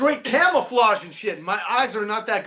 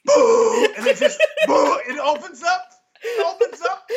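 high laughing mock whoosh of the toilet opening; imitated toilet whoosh; 11 semitones higher, 3 dB louder than usual. Telling a friend about the train toilet, a high laughing whoosh. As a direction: make the toilet's boo sound, laughing. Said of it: "The toilet goes".

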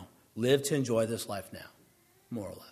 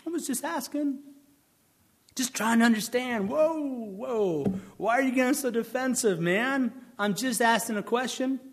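much higher, faux-innocent passive-aggressive whine; passive-aggressive questioner feigns innocence, calls others defensive; mock innocent asker; 9 semitones higher than usual. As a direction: act wounded innocence while needling. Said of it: "posturing humility".

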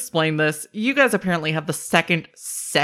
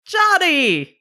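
high, loud, delighted shout of a name; excited call; 12 semitones higher, 4 dB louder than usual. Acted. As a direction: call out his name with joy. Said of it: "She goes".

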